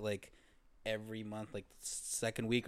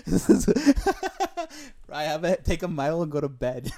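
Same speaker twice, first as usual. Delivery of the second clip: laughing; 9 semitones higher, 8 dB louder than usual. Real, not acted. Laughing repeat.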